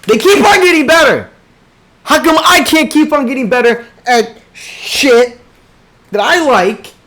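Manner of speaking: loud, high frustrated rant; loud exasperated complaint; frustrated yelling. Real, not acted. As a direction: yell it out in envy. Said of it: "I've been yelling this entire time".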